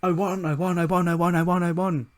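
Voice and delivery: high, loud mock victory yell; hammed-up triumphant shouting; excited celebration. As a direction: yell it over and over like a jubilant winner. Acted alarm-clock celebration of winning.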